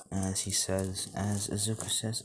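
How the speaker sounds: usual speaking voice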